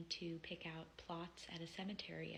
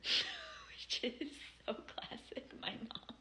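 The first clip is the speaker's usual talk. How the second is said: laughing; 5 semitones higher, much slower than usual. Real, not acted. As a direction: chuckle as you say it. Fond laugh about her mom.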